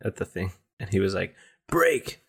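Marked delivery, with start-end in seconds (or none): 1.7-2.2 short, high imitation of a yell; imitated yell. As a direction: mimic yelling a command at an object. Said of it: "told someone to yell at the".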